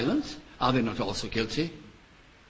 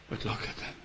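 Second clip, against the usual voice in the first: hushed aside; 3 semitones lower, 10 dB quieter, faster than usual. Acted. Preacher pointing out Israel's shame, whispered. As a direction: drop to a whisper. Sudden hush after loud preaching.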